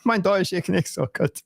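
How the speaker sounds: high, halting German; mock broken German. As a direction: attempt clumsy German in a high voice. Breaks into jokey broken German.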